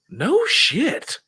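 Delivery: high impressed no shit; mimicking Doug's awed reaction; amazed exclamation. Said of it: "He's all".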